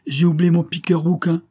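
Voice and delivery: high-pitched, mildly dismayed exclamation; lightly acted alarm at forgetting; exclaiming. A narrator's reading. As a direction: blurt out a sudden realisation, pitched high. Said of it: "s'écria le comte".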